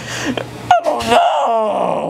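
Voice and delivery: slow teary wail, real crying; drawn-out sob through words. Real, not acted. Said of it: "Why are you crying?".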